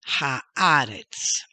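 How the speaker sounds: HAARETZ said emphatically, loud on the AA; model pronunciation. Shown as a demonstration. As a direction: ring out haaretz for learners. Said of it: "word is".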